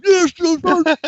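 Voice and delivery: high, squeezed mock reply; cowed recruit. Recruit with dirt in mouth, strained squeak. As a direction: squeak it like a grabbed private.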